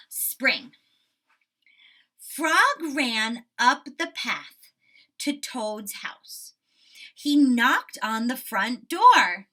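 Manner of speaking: put-on slow, loud read-aloud to children; story narration. A narrator's reading. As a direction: read it out big and slow for kids.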